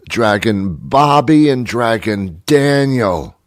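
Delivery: ordinary talk, nothing marked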